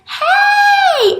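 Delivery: high, loud cheerful "Hey!"; bright greeting shout; excited shout. Acted. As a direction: call out like a happy letter. Letters greeting each other, shouting.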